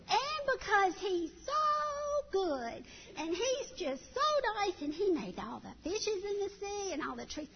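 put-on high, slow eager two-year-old; two-year-old rambling answer to catechism, high; chattering toddler. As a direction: gush like a little child. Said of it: "This child was going on".

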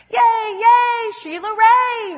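put-on loud, high cheer of a crowd of kids; cheering yell. Friends cheering Sheila Ray on, a loud high cheer. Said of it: "her friends yelled".

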